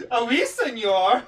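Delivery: mock French with a Spanish twist, slow; drunken fake French, loud and high; bad French accent. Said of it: "my drunk ass going".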